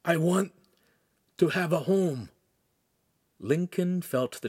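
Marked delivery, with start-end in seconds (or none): 0.0-2.3 halting, earnest, pitched up; solemn youth's higher voice. A narrator's reading. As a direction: pause mid-wish, utterly sincere. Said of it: "Ugolino said solemnly".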